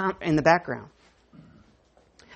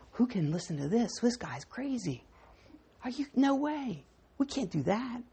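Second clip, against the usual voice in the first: much softer, muttered complaint; murmuring grumble; 11 dB quieter than usual. Retold or imitated. Disciples in the corner complaining about Jesus, softly muttered. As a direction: mutter it like a disgruntled crowd. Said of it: "they're conferring or murmuring or mumbling".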